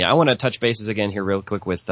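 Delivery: usual speaking voice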